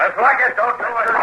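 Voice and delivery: desperate plea, pitched very high; terrified plea. Acted. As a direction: beg for your life, voice shooting way up. Man begs woman not to shoot.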